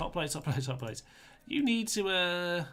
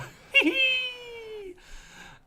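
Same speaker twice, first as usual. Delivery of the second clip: a much higher burst of helpless laughter; high squealing laugh; 6 dB louder than usual. Real, not acted. Cracking up at his own battle, high squealing laugh.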